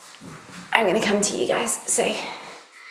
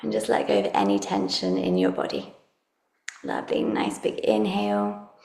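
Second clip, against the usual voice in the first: calm meditative tone; 7 dB quieter than usual. Acted. Leads a guided relaxation.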